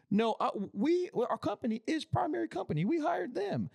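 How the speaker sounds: squeaky sarcastic imitation of the client; high mock whiny customer voice. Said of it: "They're like".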